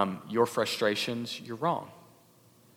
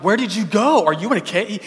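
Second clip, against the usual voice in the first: mock puzzled God, higher and louder; confused God; 7 semitones higher, 7 dB louder than usual. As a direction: play God as if lost and searching. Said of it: "It's not because God's like".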